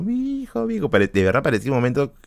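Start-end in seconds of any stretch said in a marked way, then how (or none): 0.0-0.9 high, wailing imitation of a sobbing mother; mother crying. Mother runs in crying over her son, high mock wail. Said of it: "también llorando".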